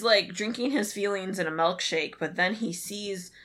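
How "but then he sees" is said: usual speaking voice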